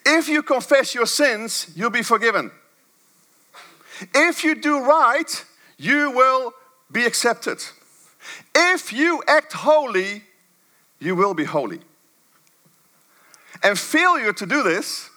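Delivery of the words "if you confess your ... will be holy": higher, slow, measured statements of a legalist creed; slow preaching cadence. Acted. Preacher parodying a works-based message, slow and raised. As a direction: lay out each condition slowly, a little higher.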